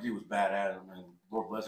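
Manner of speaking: usual speaking voice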